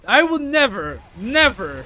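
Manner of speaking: never punched loud and firm; stress on never. Real, not acted. Repeating refusal with emphasis.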